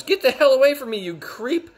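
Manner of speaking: high, loud hammed-up outrage; mock angry yell. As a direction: shriek at the creep like an angry mom. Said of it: "She goes".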